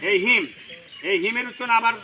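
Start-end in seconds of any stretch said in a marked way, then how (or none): none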